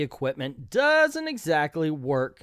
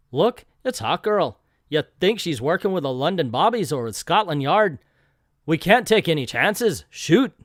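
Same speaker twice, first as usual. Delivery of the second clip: lightly put-on Irish accent for the gunmen; a hint of a stage Irish brogue; mock Irish accent. A comic reader voicing the Irish gunmen in a light mock Irish accent. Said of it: "I know my Irish accent is just about as offensive".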